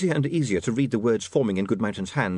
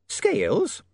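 high puzzled landlady; baffled landlady voice; shrill nagging landlady; 11 semitones higher than usual. Acted.

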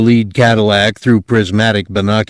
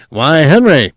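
raised, louder voice of an excited commentator; growing excitement; 6 semitones higher, 5 dB louder than usual. A narrator's reading. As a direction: let the excitement start to build. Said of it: "in a voice of growing excitement".

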